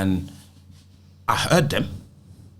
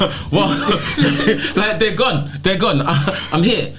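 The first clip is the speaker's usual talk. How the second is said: laughing, higher and bright with relief; laughing disbelief; 7 semitones higher, slower than usual. Real, not acted. Laughing as he tells the podcast crew how it clicked. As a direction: laugh it off like it suddenly makes sense. Laughing at his own realisation.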